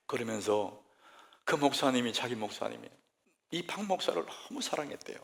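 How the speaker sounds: ordinary delivery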